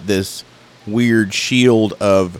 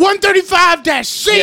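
mock yell. Imitating his friend screaming codes at the neighbor, hammed-up shout. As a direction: yell the code number like a raving cop. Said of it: "I remember you yelling".

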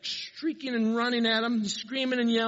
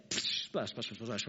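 splash noises; 9 semitones lower, 7 dB quieter, slower than usual. Retold or imitated. Imitating pigs hitting the water.

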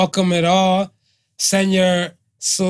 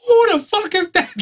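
high startled yell of a homeowner; high mock-alarmed shout. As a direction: snap it out like you caught a stranger. Homeowner spotting car in driveway.

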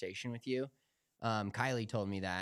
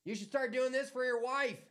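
mocking cashier voice; 13 semitones higher than usual. Cashier teasing the customer through a coworker, high mocking voice. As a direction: taunt the customer in a high, hammed-up voice. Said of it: "She goes".

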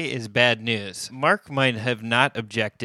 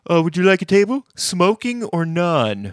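high, louder mock host greeting; restaurant host; 6 semitones higher, 5 dB louder than usual. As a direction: chirp it like a host seating guests.

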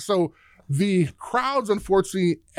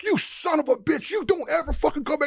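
much higher, changed voice, cursing him out; furious coach; 10 semitones higher than usual. Retold or imitated. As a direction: tell the recruiter never to come back.

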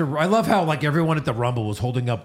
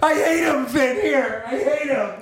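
high-pitched, hammed-up hatred; strained mock shouting in high pitch; mock promo rant; 10 semitones higher than usual. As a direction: imitate a flustered wrestler's outburst. Mocking a wrestler's overwrought promo.

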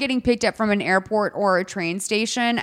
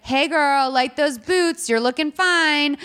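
higher, slow, mock flirty cat voice; flirty Grumpy Cat; 5 semitones higher, slower than usual. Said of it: "why is Grumpy Cat all of a sudden talking like that".

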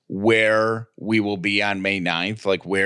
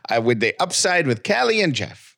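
higher-pitched mock-Irish show welcome; attempted Irish accent; 6 semitones higher than usual. Host welcomes listeners for Saint Patrick's Day, mock Irish accent. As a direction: greet listeners in a playful put-on Irish lilt. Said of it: "in your best Irish accent".

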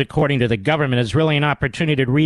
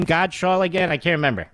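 ordinary delivery

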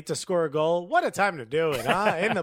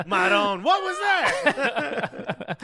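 a hammed-up Italian voice, high and drawn out; mock Italian accent; 6 semitones higher, much slower than usual. Host joking that he sounds Italian, in a mock Italian accent. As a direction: exclaim like a cartoon Italian, laughing. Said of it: "Am I Italian?".

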